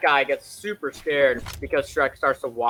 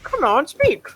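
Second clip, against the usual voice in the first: high, loud coaxing order; urging witch; 13 semitones higher, 7 dB louder, slower than usual. Retold or imitated. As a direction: push the animal to talk, pitched up. Acted witch urging Donkey.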